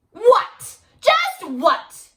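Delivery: loud, rising, annoyed what; aggravated. Acted. Book character aggravated that nobody finishes its sentence. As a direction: demand the missing word, irritated. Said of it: "he sounds aggravated".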